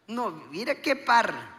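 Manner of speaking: ordinary talk, nothing marked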